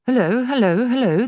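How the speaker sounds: high, loud parrot call. A narrator's reading. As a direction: shout down the chimney like a bird. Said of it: "the bird called down".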